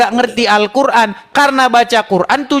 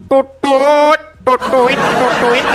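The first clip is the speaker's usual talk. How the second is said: lightly voiced flute toots; flute imitation; much slower than usual. Shown as a demonstration. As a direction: mimic a Batak flute melody. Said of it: "didengarnya lah seruling Batak".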